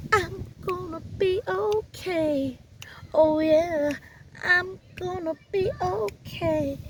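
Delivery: high, slow sing-song "I'm gonna be okay"; self pep talk. Pretend. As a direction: sing it to yourself getting out of bed. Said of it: "you be like".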